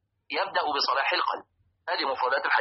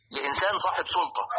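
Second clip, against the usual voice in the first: ordinary delivery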